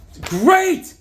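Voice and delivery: high, loud burst of enthusiasm; excited shout. Real, not acted. Vlogger raves about the comic, excited shout. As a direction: belt out the praise.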